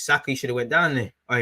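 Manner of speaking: ordinary talk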